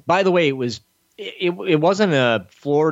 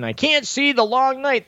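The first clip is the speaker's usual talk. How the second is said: high, mocking whine; mock-whining fan voice, much higher; whiny complainer; 13 semitones higher than usual. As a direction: parody the fans griping about the dark episode. Mocking complaining Game of Thrones viewers.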